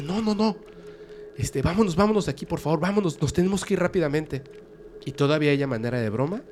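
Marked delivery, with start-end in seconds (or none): 0.0-4.4 high, urgent pleading; terrified pleading. Acted. Barney begs his wife to flee, high terrified pleading. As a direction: beg to get away fast.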